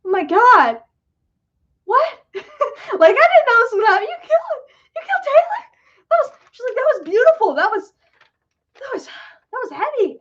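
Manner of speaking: high mock-stunned gush over a scene; imitating shocked friend. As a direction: mimic a stunned, tearful reaction, pitch way up. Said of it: "She was like".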